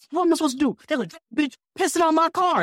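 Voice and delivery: high, indignant outburst; outraged driver. Retold or imitated. Driver complaining the customer is peeing on his car, high outrage. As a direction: squeal it out in disbelief.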